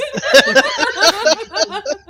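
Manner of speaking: very high, loud laugh; real shrieking laughter. Real, not acted. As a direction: burst out laughing. Told to stop cooking.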